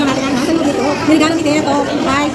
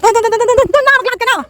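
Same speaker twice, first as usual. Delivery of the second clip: calling out; 9 semitones higher than usual. Real, not acted. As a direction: call the dog over there, eagerly. Urging the dog to go.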